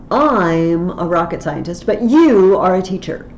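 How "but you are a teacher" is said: "you" hit louder as the contrast word; stress on you. Shown as a demonstration. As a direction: set "you" against the I before it. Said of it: "I'm pointing out you".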